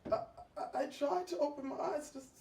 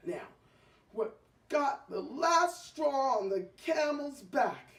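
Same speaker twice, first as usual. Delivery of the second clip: usual speaking voice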